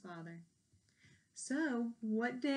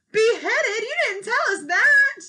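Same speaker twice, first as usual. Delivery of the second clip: high, loud, alarmed outburst; shocked alarm; 12 semitones higher, 14 dB louder than usual. Acted. Paulina alarmed at the queen's threat, loud shocked cry. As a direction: gasp it out in disbelief.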